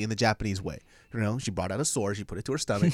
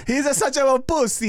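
put-on high, louder Japanese accent; 8 dB louder than usual. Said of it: "my Japanese accent came off kind of Italian".

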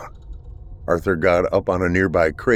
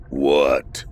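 low guttural grunt through a door; a low creature growl; 6 semitones lower than usual. Acted. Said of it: "a guttural voice came from the other side".

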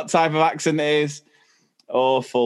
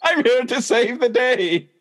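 high, drawn-out heroic announcement; mock superhero; 7 semitones higher than usual. Joking about a superwoman rushing out to help, mock-heroic. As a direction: declare it like a hammed-up superhero.

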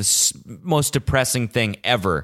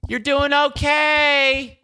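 put-on very high, slow, sing-song old lady; old lady on her porch cheering runners; imitating old lady; much slower than usual. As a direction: drag it out like a sweet old lady. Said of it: "she goes".

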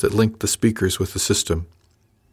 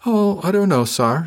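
higher, stretched-out teasing drawl; 9 semitones higher than usual. A narrator's reading. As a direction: drawl it out, ready to zing him. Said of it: "with a drawl that meant I was about to get zinged".

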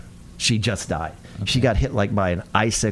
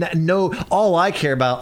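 normal talking voice

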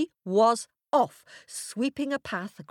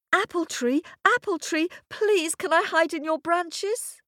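put-on pleading girl; 10 semitones higher than usual. A narrator's reading. Girl begs the tree.

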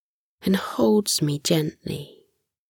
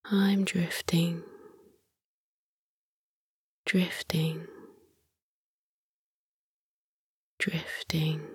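fading whisper; 11 dB quieter than usual. Acted. Repeated words fading into sleep.

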